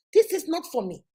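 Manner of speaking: normal talking voice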